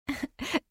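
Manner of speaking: soft, high giggle; short light child's giggle. A narrator's reading. Daughter amused at her hiding mother, soft giggle. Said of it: "hörte sie Livy kichern".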